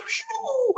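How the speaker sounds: long, very high "shoo"; a drawn-out high swoosh for a tumble downhill; imitated whoosh.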